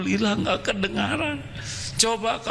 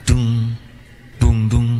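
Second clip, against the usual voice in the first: thumping sound; 6 semitones lower, 5 dB louder, slower than usual. Pretend. Imitating blocked ear thumping.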